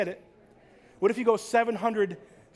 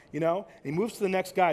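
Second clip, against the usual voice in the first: usual speaking voice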